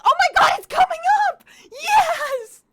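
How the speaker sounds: very high, loud laughing shout; excited shout. Real, not acted. Streamer thrilled that Grilled Cheese Day is coming, shouting. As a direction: burst out with joy.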